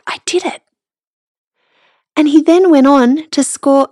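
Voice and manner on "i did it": a hushed, awed whisper. Retold or imitated. Son's whisper after his first goal, hushed awe. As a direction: breathe it out in quiet disbelief. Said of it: "he just went, like".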